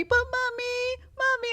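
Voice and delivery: put-on very high, drawn-out whiny kid voice; pleading child. Son runs to mom after dad says no, pleading child. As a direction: imitate a little boy begging mommy. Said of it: "comes running over to me".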